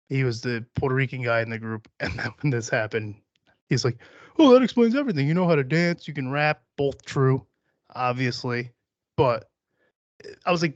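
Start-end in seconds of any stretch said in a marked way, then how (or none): 4.4-6.6 higher, louder, delighted discovery; mock amazed realisation. Buddy hears he's Puerto Rican, mock excitement. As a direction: imitate a buddy who just figured it all out.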